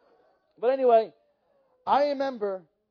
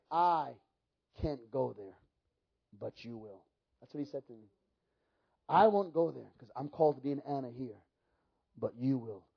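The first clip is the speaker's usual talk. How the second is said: hushed solemn quote; 11 semitones lower, 7 dB quieter than usual. Retold or imitated. Mike's solemn prophecy retold.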